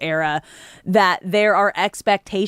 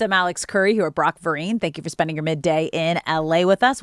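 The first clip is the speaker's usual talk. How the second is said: usual speaking voice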